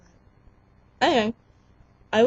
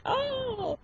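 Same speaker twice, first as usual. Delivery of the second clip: over-bubbly; 6 dB quieter than usual. Shown as a demonstration. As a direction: squeal it as if totally bubbly. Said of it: "totally bubbly and be like".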